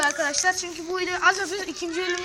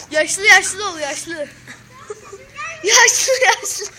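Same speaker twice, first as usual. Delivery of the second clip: put-on louder, higher call to act old; loud urging; 4 semitones higher, 8 dB louder, slower than usual. Shown as a demonstration. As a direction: push him to act old, loudly. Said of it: "Yaşlı hareketi". (yüksek sesle ısrarla)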